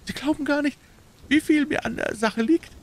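hohe, eifrige Männleinstimme; aufgeregtes Rufen. A narrator's reading. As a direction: dem Helfer dringlich beteuern. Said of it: "rief der kleine Bursche". (bewegter kleiner Bursche)

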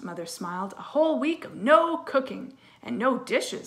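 lively storybook read-aloud; reading to children. Acted. Teacher reading a story to her class, lively storytelling. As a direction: read it brightly to young kids. Reads a picture book aloud.

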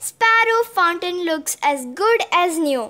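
ordinary delivery, nothing marked

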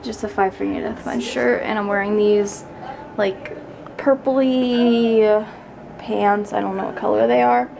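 ordinary talk

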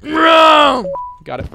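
very high, loud cry; sudden high-pitched holler; triumphant yell. Real, not acted. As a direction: yell it out as you land it. Yells on getting it in game.

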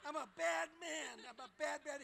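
chuckling through the words; laughing. Real, not acted. Laughing at a fan after boasting he's bad. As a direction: laugh while owning the bad-guy label.

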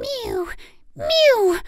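high pleading cat mew. A narrator's reading. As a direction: mew at the door like a hungry cat. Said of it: "interrupted Simpkin".

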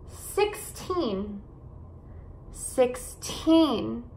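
put-on SIXTEEN with a long, aspirated TEEN; six-TEEN, careful teacher's model; stress on final syllable. Shown as a demonstration. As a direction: lean on the end of SIXTEEN. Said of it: "esses números com teen".

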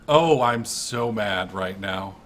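usual speaking voice

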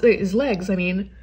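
ordinary talk

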